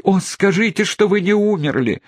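high, loud hysterical cry of relief; hysterical exclaiming. Acted. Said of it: "восклицала истерическая леди". (истерическое восклицание облегчения)

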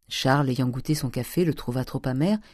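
plain reading voice

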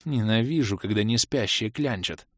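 ordinary delivery, nothing marked

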